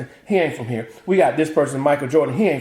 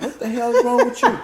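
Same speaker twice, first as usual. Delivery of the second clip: much higher mock disbelief with a laugh; incredulous family; 11 semitones higher than usual. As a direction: play the baffled relatives. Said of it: "they're like".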